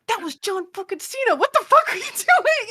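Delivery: high, rising disbelief at her; exasperated friend scolding her; hammed-up outraged squeal; mock incredulous yell. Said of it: "he was like".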